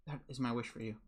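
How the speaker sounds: ordinary delivery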